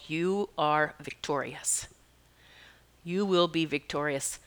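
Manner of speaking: a slow, hushed inner voice of reassurance; slow soft bird's message. Pretend. Bird speaking to her in her mind, slow and hushed. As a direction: let the words land softly and slowly.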